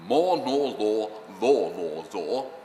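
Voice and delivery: measured, rounded vowel exercise; elocution drill. Retold or imitated. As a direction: intone it like a diction exercise.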